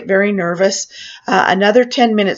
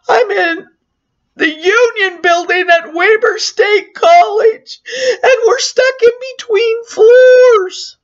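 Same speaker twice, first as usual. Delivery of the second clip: much higher, slow, teary and loud; crying panic; 12 semitones higher, 4 dB louder, slower than usual. Retold or imitated. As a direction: drag out the location between sobs. Panicked caller giving location to dispatcher.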